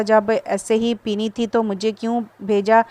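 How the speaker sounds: ordinary delivery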